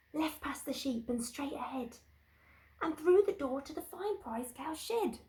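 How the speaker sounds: high little storybook creature; little ladybird. Acted. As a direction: tell the secret like a tiny insect. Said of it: "the little spotty ladybird, who never before had said a word, told the animals".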